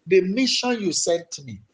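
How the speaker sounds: fervent prayer said for them to copy; model prayer. Shown as a demonstration. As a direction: show them how to beg the Father. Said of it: "I want you to go before your father".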